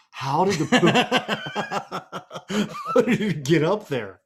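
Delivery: astonished question cracking into laughter; retold bafflement. Storyteller relives finding the mess high on the wall, baffled. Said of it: "I stood up and I go".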